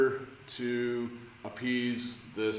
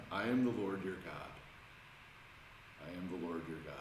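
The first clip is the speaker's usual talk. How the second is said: soft reassuring voice of God; 4 semitones lower, 9 dB quieter, faster than usual. Pretend. Imagining God's words for the first time.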